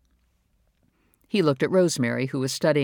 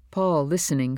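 ordinary delivery

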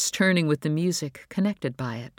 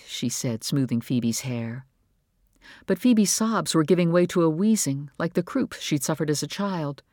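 ordinary delivery, nothing marked

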